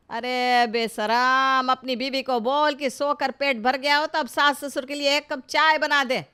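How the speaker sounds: mother-in-law scolds her son sharply; angry scolding. Acted.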